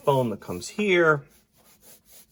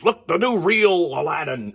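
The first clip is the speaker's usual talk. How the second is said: mock ad announcer; 8 semitones higher, 7 dB louder than usual.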